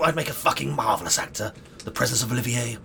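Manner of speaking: normal talking voice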